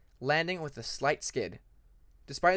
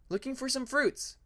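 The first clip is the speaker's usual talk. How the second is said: light cheery voice; 10 semitones higher than usual. Acted. Peacemaker answering Cliff cheerfully.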